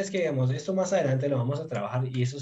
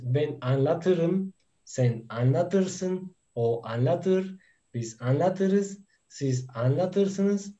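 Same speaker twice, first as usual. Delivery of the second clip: drawn-out teacher's recitation, each form slowly spelled out by ear; slow, measured language-drill delivery; slow model conjugation; 3 semitones higher, much slower than usual. Shown as a demonstration. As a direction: recite the verb forms very slowly for learners.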